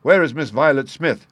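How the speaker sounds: quick and clear. A narrator's reading. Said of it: "he said in a quick, clear way".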